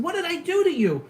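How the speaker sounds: high, loud exasperated retort; indignant retort. Retold or imitated. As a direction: throw it back at the screaming driver. Retold reply to screaming driver.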